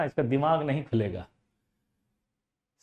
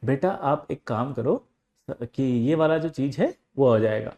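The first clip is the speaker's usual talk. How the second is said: gentle teacher; 4 semitones lower than usual. Retold or imitated. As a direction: coax the student kindly. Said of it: "फिर teacher प्यार से बोला".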